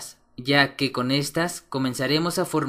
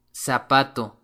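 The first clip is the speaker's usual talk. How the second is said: usual speaking voice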